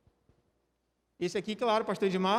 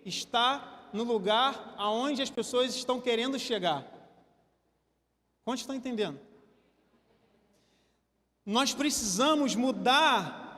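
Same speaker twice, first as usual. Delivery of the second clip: normal talking voice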